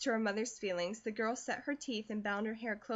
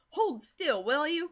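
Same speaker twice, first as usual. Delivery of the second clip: louder, higher impatient order; hurried louder command from a fussing mother; mother crying out; 5 semitones higher, 6 dB louder than usual. A narrator's reading. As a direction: snap at her to hold still, louder. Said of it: "cried Missus Comstock".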